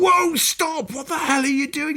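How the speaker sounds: high squeaky Welsh panic; retold mate shouting stop in a put-on Welsh accent. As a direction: yelp it in a sing-song Welsh voice. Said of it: "I'm gonna do the accent in a minute".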